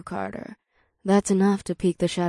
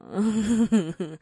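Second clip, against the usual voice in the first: amused laugh; 2 semitones higher than usual. A narrator's reading. Laughing at the plan.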